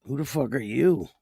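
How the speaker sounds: fuller, confrontational take on the line; mock challenge. Host firing a chatter's rude question back, mock confrontational. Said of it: "Don't come in here talking like that".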